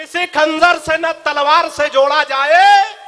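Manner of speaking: slow, loud, declaiming a couplet; poetic recitation. Shown as a demonstration. Comic poet declaims his couplet to the audience, slow and loud. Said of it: "चार पंक्तियां सुनिएगा".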